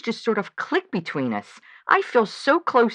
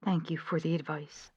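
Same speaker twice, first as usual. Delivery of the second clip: subdued thanks; 3 semitones lower, 10 dB quieter than usual. Acted. Quiet thanks after giving in.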